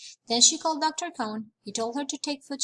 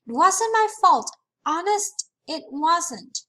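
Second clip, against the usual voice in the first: crying protest; 6 semitones higher, 7 dB louder than usual. Acted. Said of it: "Sheila cried".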